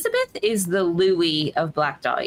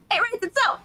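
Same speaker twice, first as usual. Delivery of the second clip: falsetto; slower than usual. Pretend. Laughing falsetto remark.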